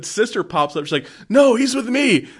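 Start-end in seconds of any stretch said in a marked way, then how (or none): 1.3-2.3 high, loud mock cry of protest; retold urgent shout. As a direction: call out to stop them, pitched way up. Sister shouts to stop the elves.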